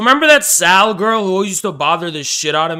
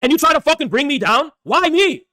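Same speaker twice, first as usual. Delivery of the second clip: raised-pitch, heated rant at the community; angry rant; 7 semitones higher than usual. Real, not acted. Streamer ranting at his chat about being brought down, heated and high. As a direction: let the frustration pour out high and hard.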